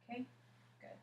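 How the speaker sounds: usual speaking voice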